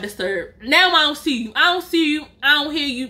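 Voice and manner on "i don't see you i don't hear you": usual speaking voice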